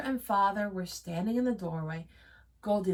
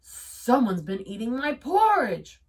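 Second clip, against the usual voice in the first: roar; 3 semitones higher, 7 dB louder than usual. A narrator's reading. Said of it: "roared Papa Bear".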